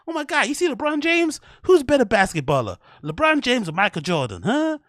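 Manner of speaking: mock a hacky comic's excitable American accent. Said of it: "that kind of voice inflection".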